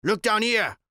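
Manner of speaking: calling to a plane overhead for rescue; shouting. A narrator's reading. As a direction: yell up at the aircraft. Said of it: "He shouted".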